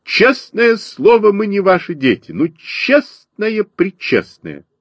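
high, slow, earnest protest; pleading in defence. A narrator's reading. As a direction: swear it emphatically, pitched high, for a friend. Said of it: "вступился я за своего друга". (горячо заступается, высоким голосом)

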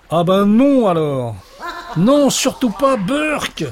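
put-on a frightened boy crying out; terrified cry. A narrator's reading. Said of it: "Et Léon, terrifié, s'écria". (Léon, terrifié, s'écrie et supplie le cauchemar)

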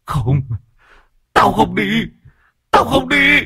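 gào rú cao dần, to hơn; hét van trong hoảng loạn; rú lên. A narrator's reading. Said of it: "mà rú lên". (vong rú lên từ van lơn đến gào thét)

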